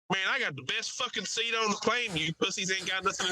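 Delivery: imitated cocky Mississippi loudmouth; crazy Mississippi accent. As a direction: brag to the boys in a Mississippi accent. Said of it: "The guy has a crazy accent".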